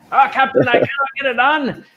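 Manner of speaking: high Scottish engineer protesting to the captain; hammed-up Scotty pleading he can't do it; Scotty impression. Host imitating Scotty telling the captain it can't be done, Scottish accent. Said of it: "what would Scotty do?".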